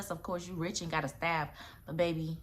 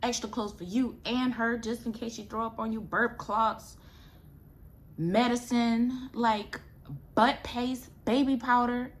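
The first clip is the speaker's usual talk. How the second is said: nagging mom listing; 6 semitones higher, 5 dB louder than usual. Retold or imitated. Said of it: "my mom's like".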